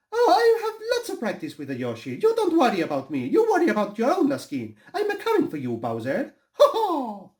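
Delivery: very high, cocky cartoon voice with a put-on accent; confident cartoon hero. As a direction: brag to the villain, high and laughing.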